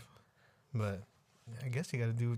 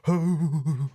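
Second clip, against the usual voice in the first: hammed-up spooky ooh, pitched up; ghost moan; 6 semitones higher, 10 dB louder than usual. Friend voicing the spooky moan of Dark Ass.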